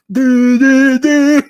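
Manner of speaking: loud, high, drawn-out honks; imitated bus horn. Streamer shows what the bus horn does, loud honking. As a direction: honk like someone pressing the horn. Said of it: "You press it, it goes".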